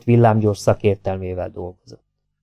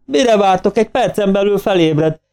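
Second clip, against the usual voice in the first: magas hangon sorolt, sürgető parancsok; végig emelt hangú kiabálás, teljes hangerő nélkül; üvöltés; 9 semitones higher than usual. A narrator's reading. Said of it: "üvöltötte". (türelmetlenül kiáltott utasítások)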